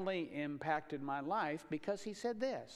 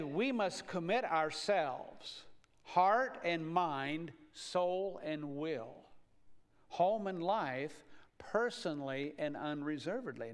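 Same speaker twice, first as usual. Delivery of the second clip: measured quote; slower than usual. Retold or imitated. Quoting Stott slowly, measured.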